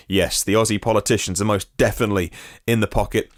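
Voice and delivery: ordinary talk, nothing marked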